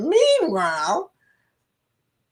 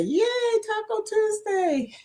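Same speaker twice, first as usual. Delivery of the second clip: high-pitched little yay; playful cheer; 12 semitones higher, 3 dB quieter than usual. Pretend. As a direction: cheer in a small, high, silly voice. Mock cheer for Taco Tuesday.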